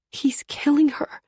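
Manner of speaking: desperate whispered plea. A narrator's reading. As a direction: breathe it out in panic.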